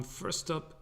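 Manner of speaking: normal talking voice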